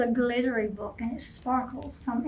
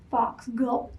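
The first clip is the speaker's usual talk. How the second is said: usual speaking voice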